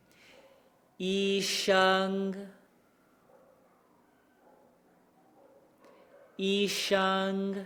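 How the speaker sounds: "医生" very slow, level high pitch; practicing tones. Shown as a demonstration. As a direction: hold "医生" flat and steady. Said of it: "that same thing happen here".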